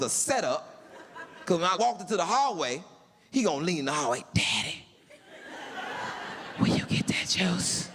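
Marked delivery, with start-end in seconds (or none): 4.3-7.9 put-on whispered sneaky child plea; mock child whisper; whispered kid voice. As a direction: hush it like a kid calling for juice. Son whispering from his room.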